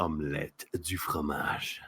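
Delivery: soft, low, slow French murmur; whispering in French. Acted. Whispering scrambled eggs and cheese in French to friends, whispered. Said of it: "You whisper scrambled eggs and cheese in French?".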